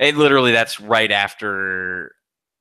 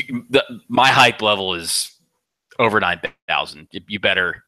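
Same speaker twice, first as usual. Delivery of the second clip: ordinary delivery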